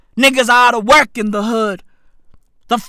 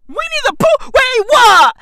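mock shocked yell; 12 semitones higher, 4 dB louder than usual. Mocking Xi Jinping in a rant.

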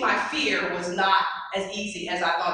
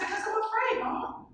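put-on much higher, softer childlike admission; scared child voice; 12 semitones higher, 6 dB quieter than usual. Girl admitting fear to her mother, high and small. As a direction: confess it like a frightened little girl.

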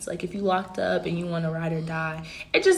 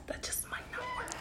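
whispered; 17 dB quieter, faster than usual. Real, not acted. Trailing off at end of thought.

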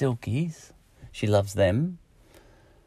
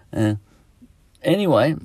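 ordinary delivery

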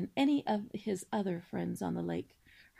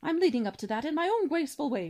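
put-on loud, higher, grandiloquent old swan; pompous cob swan; 5 semitones higher, 5 dB louder, faster than usual. Father swan listing his son's virtues, grandly booming. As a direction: declaim like a self-important father swan.

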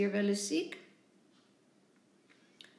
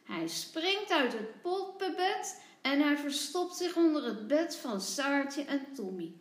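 usual speaking voice

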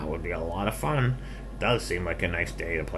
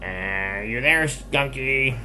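higher, slow sing-out to a hider; searching call; 7 semitones higher, 5 dB louder, slower than usual. Acted. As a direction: coax the hidden skunk out with a call. Pig calling for hidden skunk.

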